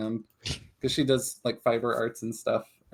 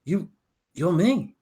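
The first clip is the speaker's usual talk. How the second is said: stunned, a touch louder; pointing out a lookalike in shock; startled bunny double; 6 semitones higher, 4 dB louder than usual. Acted. Bunny double confronting his twin, startled.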